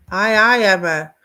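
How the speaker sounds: normal talking voice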